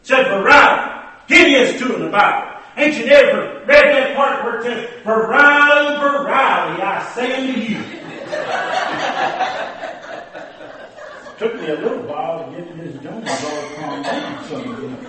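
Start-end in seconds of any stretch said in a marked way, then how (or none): 0.3-7.8 high, loud preachy rebuke; indignant scolding. Retold or imitated. Miss Jones rebukes the preacher with scripture, high and loud. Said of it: "Miss Jones got all hard up". 7.9-11.3 laughter. Real, not acted. Audience laughter.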